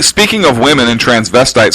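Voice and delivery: ordinary delivery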